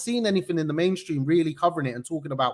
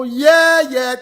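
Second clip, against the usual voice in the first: a much higher, louder hype "Yeah"; hype shout; 8 semitones higher, 11 dB louder than usual. Real, not acted. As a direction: pump up the crowd. Hype shout opening the show.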